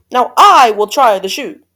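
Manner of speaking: loud, pushy announcement; demanding yell. A narrator's reading. Second sister demanding her turn at the shoe, yelling. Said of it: "who yelled".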